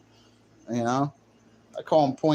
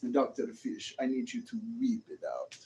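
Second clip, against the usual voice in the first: soft imitated Russian woman; a hushed put-on Russian accent; mock Russian accent; 3 semitones lower, 9 dB quieter than usual. Russian woman addressing Doctor Fish, mock Russian accent. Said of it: "the way they talk like this".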